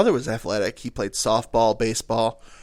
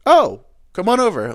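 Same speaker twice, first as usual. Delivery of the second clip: loud, raised, friendly call across a park; 8 semitones higher, 6 dB louder than usual. Retold or imitated. Dad inviting random kids to play ball, calling out. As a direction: call the kids over to join in.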